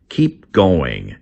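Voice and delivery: ordinary delivery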